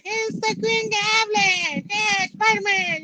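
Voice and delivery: high, slow cackling villain shout; Green Goblin voice. Retold or imitated. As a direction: ham up the cartoon Goblin. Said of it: "He kinda sounded like the".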